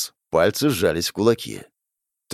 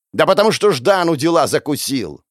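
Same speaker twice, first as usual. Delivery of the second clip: raised, high-pitched angry retort; angry yell; 6 semitones higher, 4 dB louder than usual. A narrator's reading. Gang elder to the younger boy, yelling. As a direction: yell back at him. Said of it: "заорал на меня старший".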